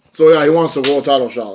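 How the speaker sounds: usual speaking voice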